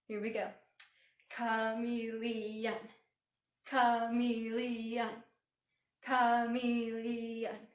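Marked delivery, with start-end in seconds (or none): 1.3-7.7 "Chameleon" in four slow, even beats; "Chameleon" as a slow punch-along chant; punched syllables. Shown as a demonstration. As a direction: punch out each syllable of "Chameleon", long and level. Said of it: "we're gonna punch it together".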